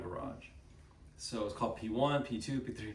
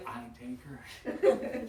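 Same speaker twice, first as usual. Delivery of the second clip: slow Southern drawl; proud, slow Southern husband; Southern accent; 3 dB louder, much slower than usual. Retold or imitated. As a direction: imitate the Southern husband, drawn out. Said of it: "he had that southern accent too".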